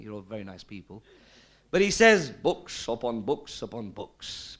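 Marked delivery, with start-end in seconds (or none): 2.3-4.6 slow, low Lancashire voice; broad Lancashire accent. Retold or imitated. Quoting the man's answer on creation, broad Lancashire accent. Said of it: "in his broad Lancashire accent".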